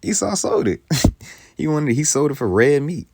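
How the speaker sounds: very high-pitched, laughing disbelief; incredulous insistence. Real, not acted. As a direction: drive the point home, high and loud. Insisting Esau sold it himself.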